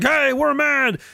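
high, limp little protest; a half-hearted mock cheer; mock feeble protest. Mocking horn-honkers, high feeble protest. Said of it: "were like".

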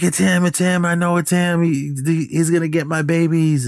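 high-pitched imitation of a frantic mother; panicked mother. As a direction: act out her panic in a high, insistent voice. Retold panicking mother on phone.

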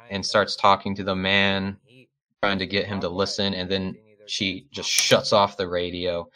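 ordinary talk, nothing marked

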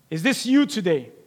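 ordinary delivery

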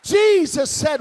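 loud, climbing preacher's shout; preaching at full force. Acted. Preacher rousing the congregation about God's care, shouting. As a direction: build it up and shout it out to the church.

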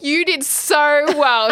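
slow, high, drawn-out praise; gushing praise, imitated. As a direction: imitate over-sweet praise for a new mother. Voicing praise given after childbirth.

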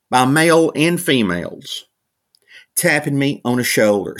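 ordinary talk, nothing marked

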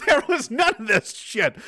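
laughing, high put-on voice of the good old days; high, slow laughing old-timer voice; mock old-timer. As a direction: play a nostalgic old-timer through a laugh.

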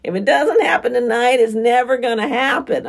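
high, loud, nasally whining voice; nasal whine. Retold or imitated. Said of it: "in the most nasally voice ever".

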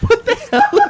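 high laughing disbelief. Real, not acted. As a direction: squeal it through laughter.

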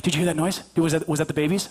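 low, stumbling wife impression; imitated anxious wife. Wife to husband about the babies, low imitated worry. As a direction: question low, as if at night. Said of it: "And she's like".

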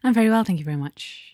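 prim upper-class English; posh voice. Acted. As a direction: put on a posh tea-drinking voice. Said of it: "What's all this posh, posh ass voice?".

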